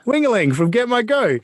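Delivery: called out high, as if from a crowd; mock yell. A fan calling out to him from the crowd, high mock shout. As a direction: shout a nickname across a room. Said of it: "someone would yell out and go".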